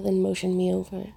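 usual speaking voice

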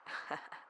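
short, quiet breath of a laugh; hushed little laugh; soft chuckle. Acted. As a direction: chuckle softly to yourself. Narrator chuckles within the story.

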